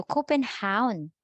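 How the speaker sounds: Copenhagen as Copen-HOWN; native Danish pronunciation. Shown as a demonstration. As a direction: turn the end of Copenhagen into hown. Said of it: "yung V nagiging U".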